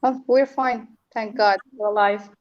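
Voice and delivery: normal talking voice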